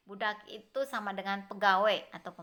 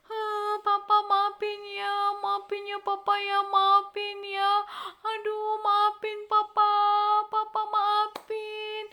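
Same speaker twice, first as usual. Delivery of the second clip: high, loud, hammed-up fake sobbing plea; fake crying; 10 semitones higher, 5 dB louder, slower than usual. Brothers fake-crying to their father, begging forgiveness. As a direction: pretend to weep to fool papa. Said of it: "anak-anak dia nangis".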